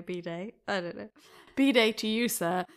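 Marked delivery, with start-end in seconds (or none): 0.0-0.5 "bidet" said bee-day, stress on the second syllable; English "bidet" as bee-DAY; British bidet. Shown as a demonstration. Explaining the English way to say it, "bidet" as bee-day. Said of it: "that's how you pronounce it anyway". 1.6-2.6 punchy bidet. Shown as a demonstration. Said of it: "that's how you pronounce it anyway".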